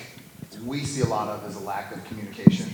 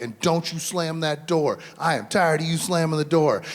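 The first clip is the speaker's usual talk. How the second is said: higher, louder exasperated mom; fed-up scolding; 7 semitones higher, 3 dB louder than usual. Retold or imitated. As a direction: snap at the door-slamming kid, pitched up. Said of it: "her mom's like".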